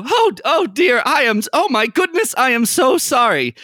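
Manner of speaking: a scraggly elf flustered by the door accident; flustered apology. Acted. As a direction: gush apologies like a fussy professor. Flustered elf apologizes after hitting Greg.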